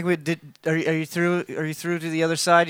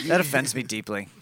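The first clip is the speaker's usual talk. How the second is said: mock deep offense; 7 semitones lower, 5 dB quieter than usual. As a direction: lower and softer, hammed-up wounded pride.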